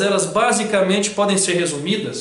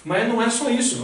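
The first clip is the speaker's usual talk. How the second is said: infomercial; 8 semitones higher than usual. Pretend. Anúncio de TV paródia.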